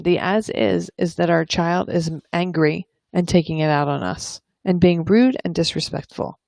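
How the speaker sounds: ordinary talk, nothing marked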